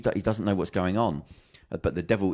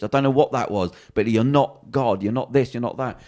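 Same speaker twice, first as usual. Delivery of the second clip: raised, higher voice, retold telling-off; exasperated confrontation; 5 semitones higher, 5 dB louder than usual. Mates in the pub challenging his claim, exasperated. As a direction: imitate a mate insisting, pitch lifted.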